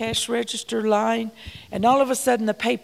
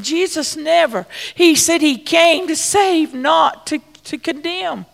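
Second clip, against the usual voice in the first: louder, higher preaching, slowing on key words; full preaching; 5 semitones higher, 7 dB louder than usual. Acted. Preacher urging the congregation to love others, rising preaching. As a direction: press the message home to the congregation. Preacher driving home the point.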